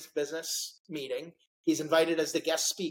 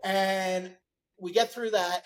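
usual speaking voice

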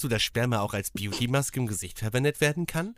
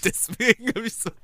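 laughing while talking; 9 semitones higher than usual. Real, not acted. Laughs mid-sentence.

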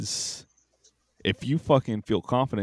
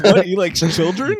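shocked listener; 8 semitones higher, 8 dB louder than usual. Retold or imitated. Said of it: "Everyone's gonna be like".

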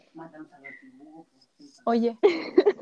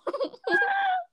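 shrieking laugh; 3 dB quieter than usual. Real, not acted. Burst of laughter at own joke.

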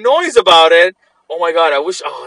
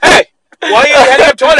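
accusing; 7 semitones higher, 5 dB louder, much faster than usual. Retold or imitated. Guy accusing Larry in stall.

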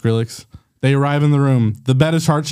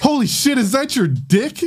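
high, hammed-up astonishment; high-pitched, incredulous question; shocked; 8 semitones higher than usual. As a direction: exclaim in mock shock. Cameron's shocked discovery in story.

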